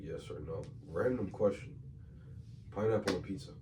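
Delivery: usual speaking voice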